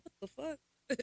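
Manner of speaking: shocked retort. Retold or imitated. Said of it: "I said".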